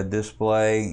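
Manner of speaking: normal talking voice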